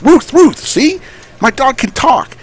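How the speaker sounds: high yelping dog bark then boast; squeaky barking dog. Acted. Dog and owner in joke.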